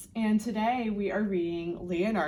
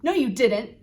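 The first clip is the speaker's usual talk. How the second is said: snapped; 4 semitones higher, 8 dB louder than usual. Acted. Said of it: "snapped Sam".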